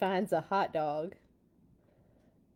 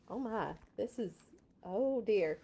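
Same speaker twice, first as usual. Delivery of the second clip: ordinary delivery, nothing marked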